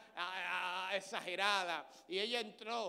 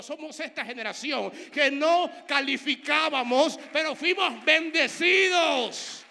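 loud, slow, ringing preaching climax; full-force preaching; 7 semitones higher, 13 dB louder, slower than usual. Acted. Preacher crying out to the church, loud and slow. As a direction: drive it home to the congregation.

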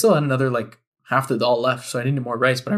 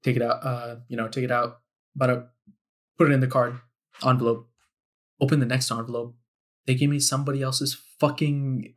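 usual speaking voice

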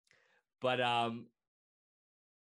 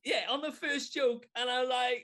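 pitched up with excitement; bubbling over about the win; excited high; 5 dB louder than usual. Real, not acted. Streamer tells cohost about the winning joke, excited.

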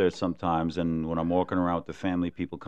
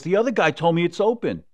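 high, aggrieved insistence; protesting customer; 11 semitones higher, 6 dB louder than usual. Retold or imitated. Fooled customer protesting to the lady, high and aggrieved. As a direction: whine that the other guy said so.

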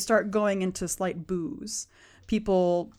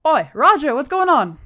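raised, higher rough-lad call to a mate; 6 semitones higher, 7 dB louder than usual. Acted. Thug holding a captive calls to his mate, calling out. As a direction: holler over to your friend, puzzled.

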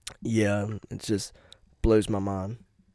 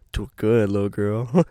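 ordinary delivery

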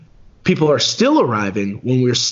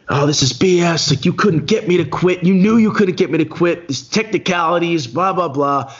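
higher-pitched mock rant at the race directors; retold angry yelling; 6 semitones higher than usual. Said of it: "I was screaming".

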